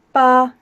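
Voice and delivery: Ba loud and level; flat first tone. Shown as a demonstration. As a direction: say Ba like a sheep, on one pitch. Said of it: "so it's flat".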